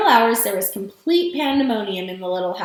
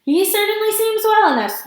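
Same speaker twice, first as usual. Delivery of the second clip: amused; 9 semitones higher, 3 dB louder than usual. A narrator's reading.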